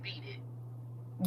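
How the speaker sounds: a soft breathy whisper; whispered quote. Retold or imitated. Caller quoting [name] in a whisper. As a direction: hush it like a secret.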